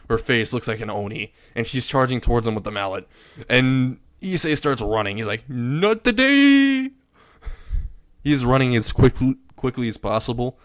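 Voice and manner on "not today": high, loud, slow stretched yell; hammed-up panicked escape shout; comic shout. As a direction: bellow it while running for your life. Fleeing a mallet-charging girl.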